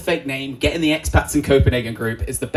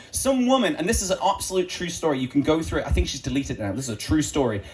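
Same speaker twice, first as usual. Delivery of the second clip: ordinary talk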